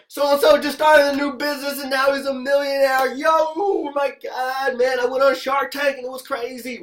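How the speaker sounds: high, loud hammed-up cheering; mock celebration. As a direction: gush over a teammate like a hyped crowd. Mock hype over fast success.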